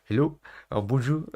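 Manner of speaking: put-on Scouse accent, a Liverpool footballer fumbling into French; Scouse footballer impression. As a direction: play a Scouser stuck at a French press conference. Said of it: "scouser in a French press conference".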